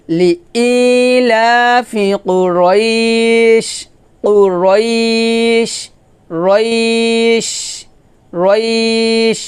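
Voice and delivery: slow tuneful recitation drawing out each word; Quran recitation. Shown as a demonstration. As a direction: chant it slowly with a long held vowel. Recites the verse.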